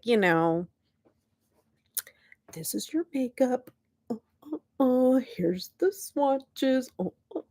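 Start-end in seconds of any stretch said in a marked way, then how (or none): 2.5-7.4 hammed-up fussy beauty presenter, softer; lightly voiced prissy tutorial host; mock snooty makeup guru. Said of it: "We shouldn't be like".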